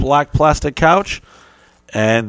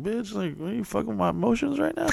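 high, soft mock disbelief; mock incredulous; 9 semitones higher, 11 dB quieter than usual. Retelling his reply to the flirting teacher, high and soft. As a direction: toss it off in a high quiet mock outrage.

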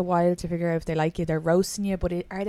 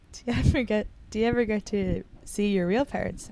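a touch higher, innocent question; naive friend asking; 4 semitones higher than usual. Retold or imitated. Friend asking the adopted guy about his parents, naive. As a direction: ask it sweetly, unaware. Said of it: "She goes".

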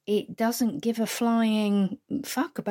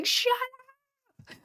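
high mock yell of shut up; shout. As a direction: bark it from a car window. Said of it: "person in their car going".

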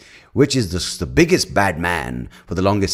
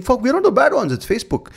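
mock politicians' outcry. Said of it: "are now saying".

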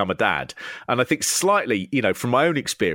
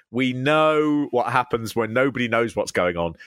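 usual speaking voice

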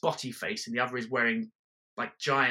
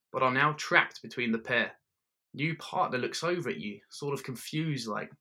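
ordinary talk, nothing marked

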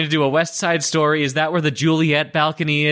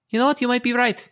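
squeaky high sarcastic; 12 semitones higher, faster than usual. Acted. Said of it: "and, uh, say".

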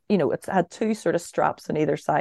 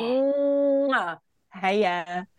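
smacking kiss, then hiya; 5 semitones higher than usual. Retold or imitated. Drunk girl kissing her boyfriend's mum, giddy mwah. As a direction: plant a big kiss and greet her. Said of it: "went straight in on her lips".

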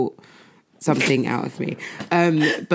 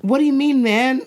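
put-on kid's London slang; 7 semitones higher than usual. Mum imitates her child's slang, raised London kid voice. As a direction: copy a cheeky London kid. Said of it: "one of them said".

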